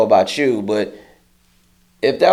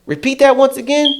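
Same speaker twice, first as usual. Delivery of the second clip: challenging dare; 12 semitones higher than usual. Retold or imitated. Challenging the coworker.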